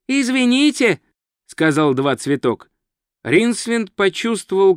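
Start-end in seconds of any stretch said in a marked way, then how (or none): 0.1-1.0 high, polite little interjection; polite tourist. Acted. Tourist politely interrupts a strangling, high voice.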